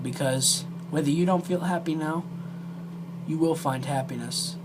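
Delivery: normal talking voice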